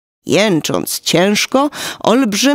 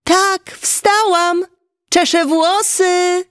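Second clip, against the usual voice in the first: put-on high, singsong girlish answer; Rosalie's voice; 11 semitones higher, slower than usual. A narrator's reading. Spit answering the giant as his daughter, high imitation. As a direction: answer in a much higher voice, stretching the last words. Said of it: "odpowiedziała głosem Rosalie".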